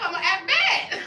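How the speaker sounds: high, loud laugh after a playful threat. Real, not acted.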